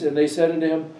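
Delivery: normal talking voice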